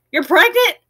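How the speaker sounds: high, astonished question; a father catching on all at once; imitating dad's disbelief; surprised dad. Dad finally realizes.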